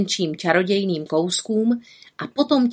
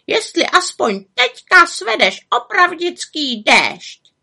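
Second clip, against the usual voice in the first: high, loud, nagging raven; reproachful raven croak; 11 semitones higher, 5 dB louder than usual. A narrator's reading. Raven listing the witch's botched spells, reproachful croak. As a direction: scold like an indignant croaking bird. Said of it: "krákal vyčítavě".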